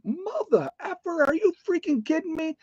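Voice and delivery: high, strained mock rage at grandpa; furious outburst. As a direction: blow up at him in a high, hammed-up rant. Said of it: "I mean, I flipped out".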